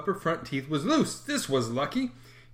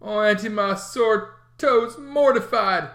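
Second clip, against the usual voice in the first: put-on sick boy voice; 5 semitones higher, 5 dB louder, slower than usual. A narrator's reading. Tom acting pain, slow.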